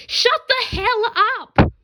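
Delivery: very high, loud, slow bellow; furious screaming blast. Acted. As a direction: roar it at the top of your lungs. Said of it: "bellowing at the top of her lungs".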